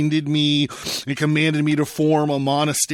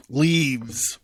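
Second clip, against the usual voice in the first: plain reading voice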